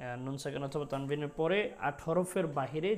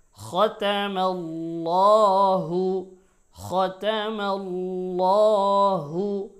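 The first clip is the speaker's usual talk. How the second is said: loud measured chant with drawn-out vowels; Quran recitation; 6 semitones higher, 13 dB louder, much slower than usual. Shown as a demonstration. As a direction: recite the verse as a teacher, every letter full and sustained. Recites verse between instructions. (জোরে টেনে টেনে তিলাওয়াত)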